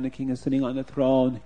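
usual speaking voice

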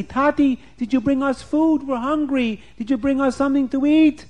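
put-on high, pleading little kid; hungry child voice. Hungry kids begging father.

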